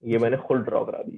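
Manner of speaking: ordinary talk, nothing marked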